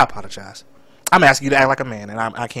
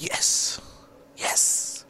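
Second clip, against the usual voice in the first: breathy whispered yes, yes; 11 dB quieter than usual. Retold or imitated. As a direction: whisper it to yourself in secret glee. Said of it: "and I'm like".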